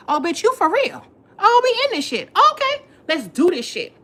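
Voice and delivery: telling off the rival, anger building to a shout; building confrontation. Retold or imitated. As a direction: build the rant toward a loud peak.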